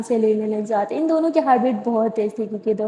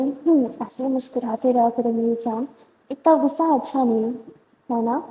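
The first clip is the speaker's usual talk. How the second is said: tender; 4 dB quieter than usual. Acted. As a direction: hold her gently with your voice. Tender words to Sara.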